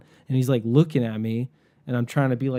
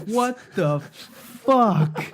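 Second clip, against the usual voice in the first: higher, drawn-out, stunned what the fuck; baffled disbelief; 9 semitones higher, 3 dB louder, much slower than usual. Retold or imitated. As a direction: stretch it out in pure disbelief. Reacting to creepy note.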